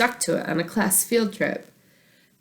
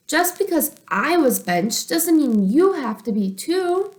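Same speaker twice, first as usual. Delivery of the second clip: determined excitement; 9 semitones higher, 4 dB louder than usual. Acted. Bobby's eager declaration.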